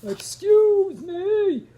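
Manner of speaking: slow, loud, high bellow; booming whale. Acted. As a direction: boom it out like a giant. Whale booms for attention.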